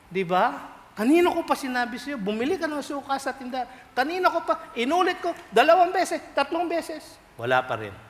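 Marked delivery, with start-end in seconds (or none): 1.0-7.2 high, exasperated scolding; nagging parent. Retold or imitated. A parent scolds a child who ignored the errand, high and exasperated. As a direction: nag the lazy child, pitched high.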